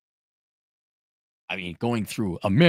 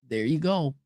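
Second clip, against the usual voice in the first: ordinary delivery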